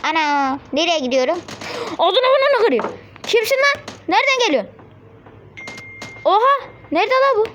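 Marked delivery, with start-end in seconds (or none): 2.0-7.4 high-pitched burst of indignation; startled angry threats. Real, not acted. Player threatening an enemy who appeared from nowhere, startled and angry.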